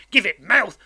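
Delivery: loud, forceful command; menacing convict. A narrator's reading. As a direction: order him to speak up.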